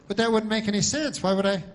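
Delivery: talking dog. Acted. Dog objecting to the clerk's extra woof, high loud protest. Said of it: "And then the dog goes".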